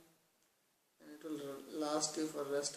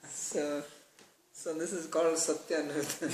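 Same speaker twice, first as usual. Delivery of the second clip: laughing; 8 dB louder than usual. Real, not acted. Still laughing after the joke.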